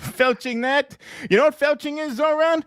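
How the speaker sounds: high, brash Trump voice rambling to a guest; Trump impression. Trump bragging to Zoran in his office, hammed-up voice.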